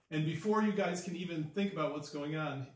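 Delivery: usual speaking voice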